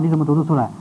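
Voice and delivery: ordinary talk, nothing marked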